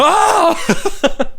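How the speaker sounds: high-pitched answering yelp; mock startled scream. Fan acting out Willie startled by the faceless guy, screaming back. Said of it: "And Willie's like".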